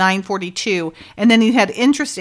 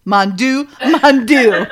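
"Mon Dieu, mon Dieu" said twice, the second time louder; a corrected line, now with its two mon Dieus; doubled mon Dieu; 5 dB louder, slower than usual. Retold or imitated. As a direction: give both "mon Dieu", the second one bigger. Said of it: "You have two Mon Dieu".

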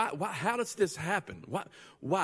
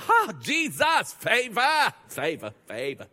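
higher, louder mock thrill, then dropping; mock rapture; 6 semitones higher, 6 dB louder, slower than usual. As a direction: gush over a bogo sale as a miracle. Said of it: "And you're like".

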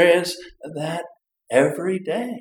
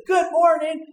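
high, loud, huffy repeat of Good morning; offended grumpy; 14 semitones higher, 5 dB louder than usual. Acted. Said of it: "in that tone of voice".

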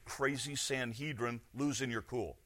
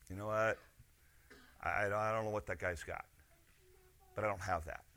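quiet inner thought; 7 semitones lower, 11 dB quieter than usual. Retold or imitated. Saul's inner thoughts voiced.

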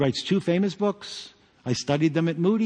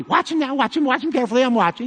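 imitating father-in-law; 8 semitones higher, 3 dB louder, faster than usual. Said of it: "he would tell me".

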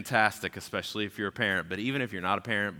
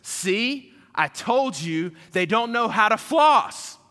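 put-on much higher, louder, slow child's told-you-so; gloating big sister; 12 semitones higher, 8 dB louder, slower than usual. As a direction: crow like a smug little kid. Older sister voiced, gloating.

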